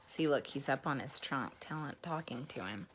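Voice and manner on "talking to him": ordinary delivery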